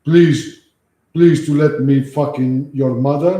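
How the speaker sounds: a light mock foreign accent, slow and pleading; broken-English accent to rile the caller; fake foreign accent. Streamer trolls scam caller in a lightly done fake foreign accent. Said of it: "I start talking with an accent".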